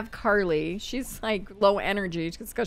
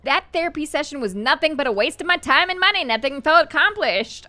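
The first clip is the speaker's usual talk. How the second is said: higher, fast, mildly angry venting; mad; 6 semitones higher, 4 dB louder, faster than usual. Acted. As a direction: voice an indignant game character complaining, mock-mad. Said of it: "Now she is mad".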